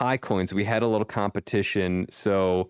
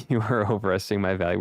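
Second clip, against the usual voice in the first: ordinary delivery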